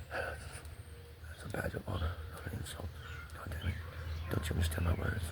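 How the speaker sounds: whispered defiance, barely voiced; hoarse whisper. Real, not acted. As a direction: hiss it under the breath, insistent. Muttering his defiance to himself.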